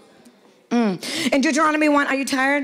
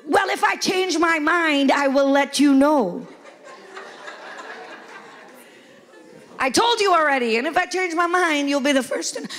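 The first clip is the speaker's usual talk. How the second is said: curt husband; 3 semitones higher than usual. Retold or imitated. Wife voicing husband's dismissive reply to her, mock curt. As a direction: lay down a smug husband's answer.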